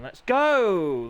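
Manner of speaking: a loud, punchy call to kick things off; announcer's rallying call; called out start. Acted. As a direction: shout it to launch the race. Calling the race start.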